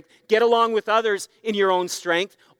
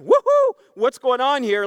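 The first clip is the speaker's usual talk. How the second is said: 1 mock excited whoop; 5 semitones higher, 5 dB louder than usual. Congregation imagined perking up.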